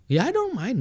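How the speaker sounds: put-on high-pitched carefree kid reply; unbothered boy. Young computer boy answering about teasing, unbothered. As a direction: brush it off in a high mock child voice.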